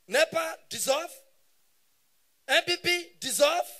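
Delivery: mock dictator's broadcast; loud, declamatory military decree; Abacha. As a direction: proclaim it like a hammed-up ruler addressing the nation. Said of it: "Abacha own announcement".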